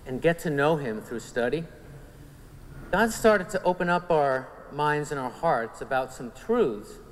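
ordinary talk

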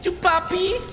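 high squeaky meme voice. Pretend. Meme catchphrase.

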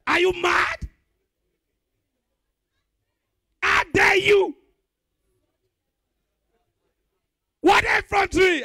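high, loud scandalised shouting; outraged shout. Acted. Comedian scolds the tattooed man, shouting in disbelief. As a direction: bellow it, appalled.

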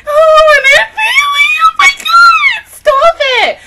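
very high wailing yell; crying shout. Real, not acted. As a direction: sob it out in anguish. Overwhelmed reaction to family.

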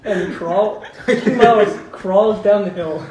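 loud, slow, laughing delivery; laughing read. Real, not acted. Narrator reading a silly line, loud laughing. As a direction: push through the giggles. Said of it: "reads through laughter".

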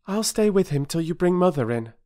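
put-on high, earnest young girl voice; a girl offering to stay and help. Bobby voiced.

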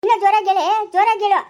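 very loud, forceful yell; full-volume irate demand; angry shout. Acted. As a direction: shout it over the quarrel. Shouted demand in a noisy quarrel.

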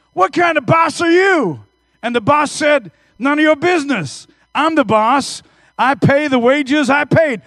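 loud high blunt boss; indignant boss. Retold or imitated. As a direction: bark back at the complaining workers. Acting out the parable boss.

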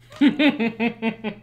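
high, loud laugh; real laugh at the quote; cracking up. Real, not acted. Laughing after reading quote.

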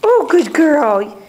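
drawn-out praise for a dog; slow doggy praise. Real, not acted. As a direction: stretch out the praise.

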